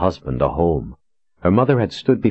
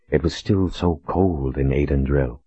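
ordinary delivery, nothing marked

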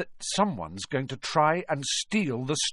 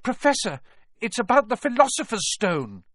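high, louder, urgent disclosure; reckless urgency; 12 semitones higher, 4 dB louder than usual. A narrator's reading. Harry reveals the secret to McGonagall, urgent. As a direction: blurt the secret, throwing caution aside. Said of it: "throwing caution to the winds".

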